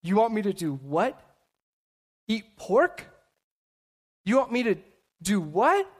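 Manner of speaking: incredulous question rising sharply on the last word; disbelief. Retold or imitated. Peter answering God's order to eat unclean food, in disbelief. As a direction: balk at it, pausing before the punch word. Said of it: "it shows Peter his unbelief".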